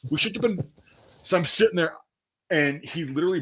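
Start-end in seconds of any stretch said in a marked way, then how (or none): 0.1-0.6 higher mock voice, brushing it off; retold reassurance. Reassuring the friend who kicked him, higher voice. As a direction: reassure him through a busted nose. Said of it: "I'm like".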